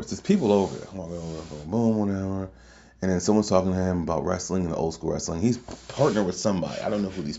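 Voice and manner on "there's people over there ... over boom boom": ordinary talk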